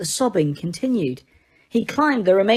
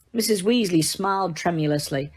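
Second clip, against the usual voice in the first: ordinary delivery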